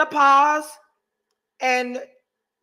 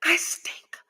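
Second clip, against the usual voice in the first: soft whisper; whispered admission to the audience; whispered confession; 7 dB quieter than usual. Real, not acted. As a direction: whisper it like a shameful secret. Confessing after the laughter.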